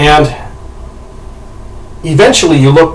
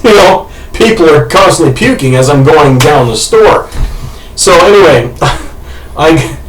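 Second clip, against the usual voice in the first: normal talking voice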